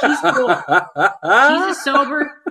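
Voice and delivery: loud, high, excited praise; gushing amid laughter. Real, not acted. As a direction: belt out the praise through shared laughter.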